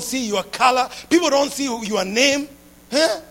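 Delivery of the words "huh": ordinary talk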